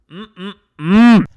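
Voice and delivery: loud satisfied mm-mm-mm; yummy hum. Real, not acted. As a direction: hum as if savoring food. Enjoying his dinner.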